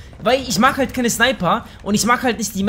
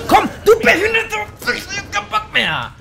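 raging squeal; 13 semitones higher than usual. Real, not acted. Raging at the game.

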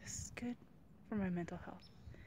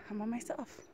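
ordinary delivery

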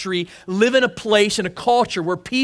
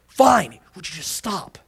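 exasperated mom. Retold or imitated. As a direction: imitate a parent caving in.

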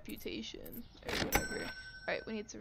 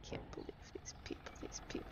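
fast hushed grumble; whispered disbelief at the customers; disbelieving whisper; 11 dB quieter, faster than usual. Real, not acted. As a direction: mutter it under your breath.

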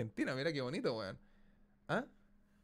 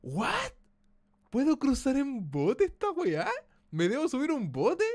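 high, loud incredulous questions; astonished disbelief; 9 semitones higher, 6 dB louder, slower than usual. Real, not acted. Streamer finds he can cross by boat, astonished. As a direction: blurt it out in raised-pitch disbelief.

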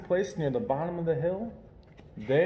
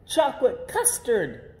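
higher, slow and delighted; excited cry; 9 semitones higher than usual. Acted. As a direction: cry out with joy. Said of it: "they cried".

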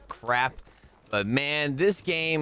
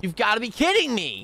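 higher, escalating disbelief; exasperated disbelief; 8 semitones higher than usual. Real, not acted. Gamer reacting to another death, exasperated disbelief. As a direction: pitch it up, unable to believe it. Repeats it more incredulously.

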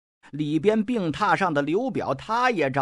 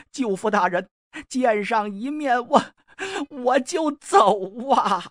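pleading; 5 semitones higher than usual. Acted. 刘琦苦苦哀求舅父.

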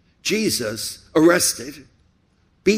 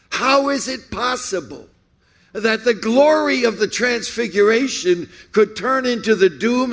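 high, forceful pulpit oratory; impassioned preaching; 7 semitones higher than usual. Real, not acted. Preacher confronts congregation with the crucifixion paradox, preaching at full force. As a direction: drive each rhetorical question out in rising waves.

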